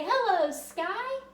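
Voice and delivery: a big, slow, happy hello upward; cheerful greeting. Shown as a demonstration. Teacher showing kids how to greet the sky, slow and bright. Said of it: "and say".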